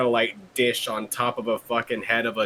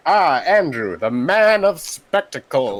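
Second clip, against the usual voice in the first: slow, higher and louder mock introduction of Andrew; mock grand announcer; 5 semitones higher, 6 dB louder, slower than usual. Co-host hamming up an introduction of Andrew as showman, slow and loud. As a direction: proclaim it like a ringmaster presenting a star.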